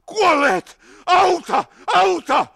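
high, loud cry for help; shouting. A narrator's reading. Wounded man answering that they are dead, shouting. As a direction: yell it out urgently.

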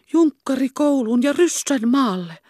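high, anxious echo; eavesdropping girl in shocked disbelief; dismayed disbelief. A narrator's reading. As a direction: repeat it in rising dismay.

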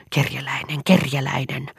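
half-whispered angry hiss; hissed contempt, partly whispered. A narrator's reading. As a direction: spit the insult out in a hiss. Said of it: "sähisi Eero".

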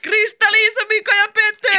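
very high, loud shout; winner announcement. A narrator's reading. Announcer reveals the winning band. (riemukas voittajien kuulutus)

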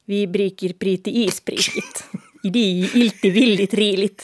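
"Vi briker priti isprikit" slowly, every vowel an i; playful slow demo of i-only vowels, breaking into laughter; i-vowel secret language. Shown as a demonstration. Answering whether she speaks it with her kids, i-vowel secret language.

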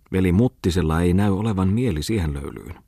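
ordinary delivery, nothing marked

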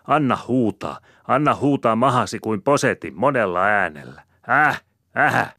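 high, loud tirade; angry scolding. A narrator's reading. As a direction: quarrelsome wife railing back. Woman scolds back in quarrel.

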